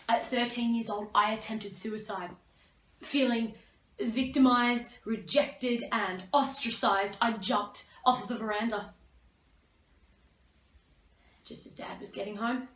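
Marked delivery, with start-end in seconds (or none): none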